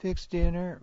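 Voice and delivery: imitating a child, pitched well up; child's reply. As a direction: pipe up as a questioning kid.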